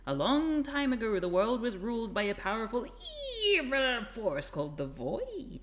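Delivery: mock-dramatic lore reading, stretching the key words; hammed-up epic narration. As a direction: read it like an overblown fantasy prologue. Reading the game's epic lore aloud.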